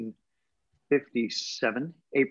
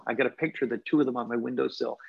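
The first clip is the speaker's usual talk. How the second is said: normal talking voice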